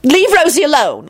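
high-pitched scolding; acted cross order; angry. A narrator's reading. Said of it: "Elizabeth said angrily".

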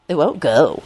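soft-edged smug remark; smirking. Acted. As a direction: say it with an audible smirk. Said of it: "told him with a smirk".

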